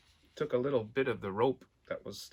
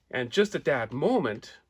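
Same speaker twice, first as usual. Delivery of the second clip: usual speaking voice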